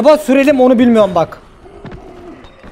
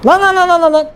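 rapid higher panicky repeats; panicked lan lan; 6 semitones higher than usual. Real, not acted. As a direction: blurt it out in a panic. Panicking while chased in game.

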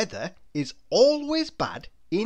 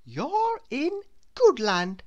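higher, slow woman's reply; 8 semitones higher than usual. A narrator's reading. Woman in Goodland telling Mister Good where he is, higher and slow. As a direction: answer kindly. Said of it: "replied the woman".

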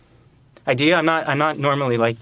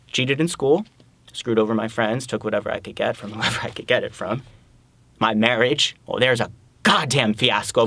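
normal talking voice

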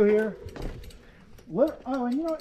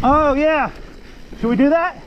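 excited shout; 6 semitones higher, 9 dB louder than usual. Real, not acted. Hyped rider shouting on trail.

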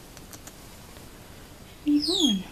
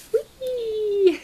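excited squeal; 6 semitones higher, 4 dB louder than usual. Real, not acted. Playful cheer with laugh.

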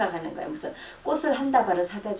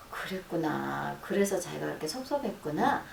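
soothing empathy; 4 semitones lower, 7 dB quieter than usual. Shown as a demonstration. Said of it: "이건 아주 좋은 말이에요".

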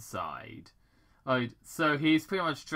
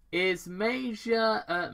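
much higher, slow, strained; frustrated Major; 10 semitones higher, 4 dB louder, slower than usual. Acted. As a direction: fight to get the word in. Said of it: "continued as he got frustrated".

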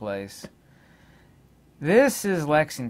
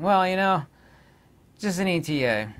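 a lightly mocking parrot of the cop, higher and louder; mock police echo; 6 semitones higher, 3 dB louder than usual. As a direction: echo his words back like a dismissive officer.

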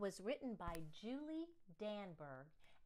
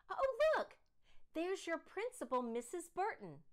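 loud, much higher excited call; excited father; 8 semitones higher, 5 dB louder than usual. Acted. Father to Sarah in the car, spotting the principal excitedly. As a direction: point her out eagerly.

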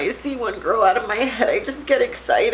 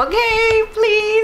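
pleading; 12 semitones higher, 5 dB louder, slower than usual. Real, not acted. Mom pleads with daughter to record.

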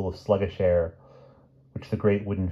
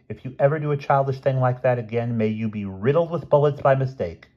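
higher-pitched, mildly indignant telling-off; scolding; 6 semitones higher than usual. Acted. Lavinia scolds a prankster who scared them, mildly indignant. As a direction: scold with a touch of outrage.

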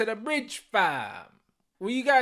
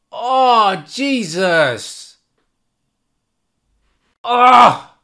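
despairing groan; 3 semitones higher, 8 dB louder, much slower than usual. Real, not acted. Groaning at miss.

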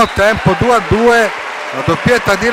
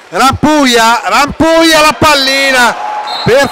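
excited commentary; 7 semitones higher, 5 dB louder than usual. Real, not acted. Live play-by-play attack.

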